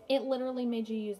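normal talking voice

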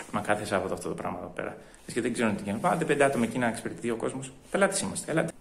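soft, low grumbling aside; muttered complaint. Acted. Customer muttering about Saturday understaffing, low grumble. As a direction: keep complaining under your breath.

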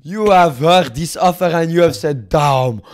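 loud, hammed-up French negotiator; put-on French accent. French envoy boasting of his offer, loud French accent. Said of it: "Fuck this French accent".

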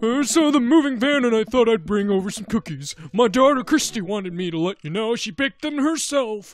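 high, loud put-on neighbor voice; odd neighbor voice. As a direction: play the chatty neighbor in a loud, silly voice. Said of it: "What kind of voice is this?".

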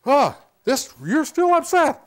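high, loud, slowed-down "still upset"; mock surprised acknowledgment. Shown as a demonstration. Counselor acknowledging the angry person again, loud and high. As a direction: lightly wave the anger past.

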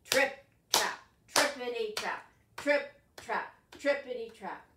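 big goat's hooves on the bridge, loud and heavy; big heavy steps. Shown as a demonstration. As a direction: stomp it out big. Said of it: "you gotta make the sound big".

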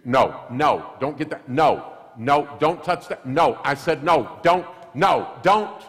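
escalating mom pleading no, no, don't; exasperated mother raising her voice; mom scolding. Retold or imitated. Mom scolding defiant child.

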